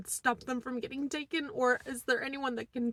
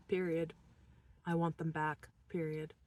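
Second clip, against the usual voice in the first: normal talking voice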